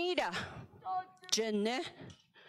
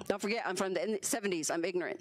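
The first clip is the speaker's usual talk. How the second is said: ordinary delivery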